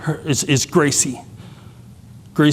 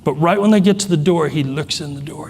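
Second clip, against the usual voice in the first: ordinary delivery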